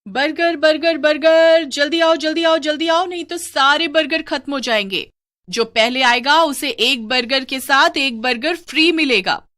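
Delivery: high, loud burger seller's cry; street vendor's hawking. Acted. Witch hawks burgers at the crossroads, high calling-out voice. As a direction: call out to pull in a crowd, pitched well up. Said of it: "burger बेचने लगती है".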